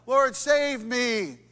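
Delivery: loud, slow cry for help; desperate plea. Acted. Peter sinking in the water, crying for help. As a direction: call out while sinking. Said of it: "cried out".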